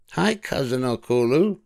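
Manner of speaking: high, cheerful storybook voice for Amelia; Amelia Bedelia voice. A narrator's reading. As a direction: lift into a bright girlish character voice. Amelia greets cousin.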